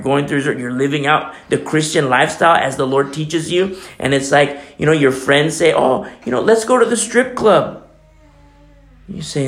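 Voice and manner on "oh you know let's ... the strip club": tempting friend. Retold or imitated.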